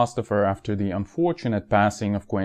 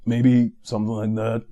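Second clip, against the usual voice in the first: trailer announcer voice; slower than usual. Acted. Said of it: "So this was the trailer for this video".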